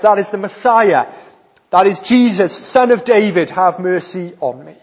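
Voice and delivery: acted urgent appeal, projected; calling out. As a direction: call out boldly to someone passing. Acting blind man calling Jesus.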